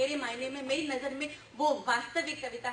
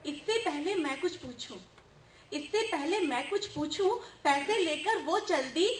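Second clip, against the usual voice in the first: animated verse, building louder; humorous poem recital; 3 semitones higher, 4 dB louder than usual. Acted. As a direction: recite the flustered mother's tale to the hall. Recites poem, voicing worried mother. (जोशीला हास्य कविता-पाठ)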